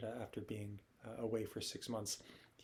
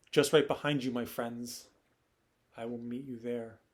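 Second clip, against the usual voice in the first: calling out; 7 dB louder than usual. Acted. Noah to the geese overhead, calling out. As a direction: call up to friends far ahead.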